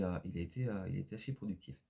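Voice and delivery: stuffy blocked nose, sick. Real, not acted. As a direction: speak through a blocked nose. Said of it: "j'ai le nez bouché".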